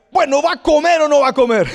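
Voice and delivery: high, loud, impatient demand; impatient usher. Retold or imitated. Usher pressing a churchgoer at the dining hall, impatient and loud. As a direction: snap at the hesitant diner. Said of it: "y te decía".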